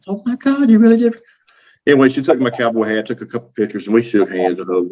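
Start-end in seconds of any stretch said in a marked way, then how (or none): 0.1-1.2 hammed-up starstruck author; mock excited squeal. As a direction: shriek of delighted disbelief, pitched way up. Amy's excited reaction retold.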